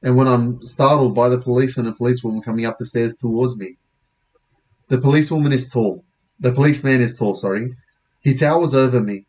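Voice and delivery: ordinary delivery, nothing marked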